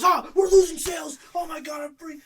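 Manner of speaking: high, loud mock panic; mock freakout. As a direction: imitate the boss losing it. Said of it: "He starts freaking out".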